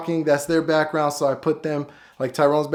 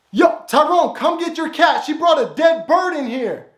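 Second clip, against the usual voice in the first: raised, higher call to a roommate; calling out to Tyrone; 10 semitones higher, 3 dB louder than usual. Acted. [name] calling Tyrone to fetch his cat, raised and higher. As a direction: yell over to Tyrone about his cat.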